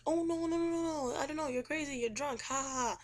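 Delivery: high sing-out denial sliding down into a scoff; exaggerated dismissive protest; flustered mock denial. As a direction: deny it in a high, hammed-up voice. Accused man's mocked, flustered denial.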